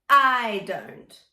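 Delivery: "I don't" with a hard glottal onset on I; glottal attack. Shown as a demonstration. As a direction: start "I" with a crisp glottal stop. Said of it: "Glottal attack".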